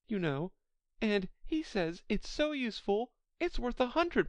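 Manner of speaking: high-pitched, eager Alice chatter. A narrator's reading. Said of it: "so Alice went on eagerly".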